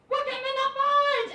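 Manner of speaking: high, loud shouted announcement; high excited shout. Retold or imitated. Maddie to the room, high excited shout. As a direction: blurt it out to the room. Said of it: "and she goes".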